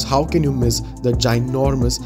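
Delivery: ordinary talk, nothing marked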